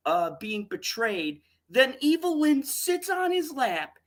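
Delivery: high-pitched, outraged disbelief; exasperated high-pitched ranting; incredulous rant. Real, not acted. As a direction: demand an explanation, voice pushed way up.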